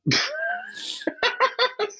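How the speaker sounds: a long high laugh; high incredulous laugh. Real, not acted. Laughs at Trump claim.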